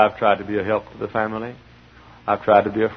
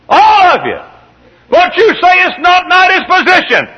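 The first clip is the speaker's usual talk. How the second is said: very high, loud shout; shouted preaching; 11 dB louder than usual. Acted. Preacher to congregation, full shouting. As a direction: shout it to the whole hall.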